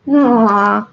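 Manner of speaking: ordinary delivery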